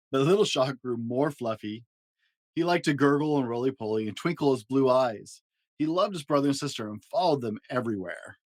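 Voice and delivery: ordinary delivery, nothing marked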